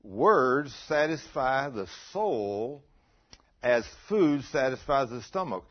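very slow, loud, stretched-out verse; deliberate, drawn-out scripture emphasis; slow emphatic repeat. Real, not acted. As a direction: drive each word home with pauses. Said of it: "listen to what that's saying".

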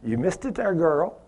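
softly nudging someone who slipped; gentle. Retold or imitated. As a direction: say it gently like a loving friend. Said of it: "Just so gently say".

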